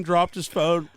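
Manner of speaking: ordinary talk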